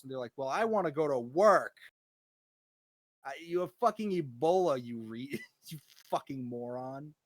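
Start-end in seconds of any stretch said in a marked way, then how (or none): 0.4-1.8 higher, louder mocking whine; whiny mock demand. Mocking the Ebola family insisting on going to work, loud whine. 3.2-7.1 higher, louder outraged shout; exasperated yell. Pretend. Shouting at the family who brought Ebola back, outraged.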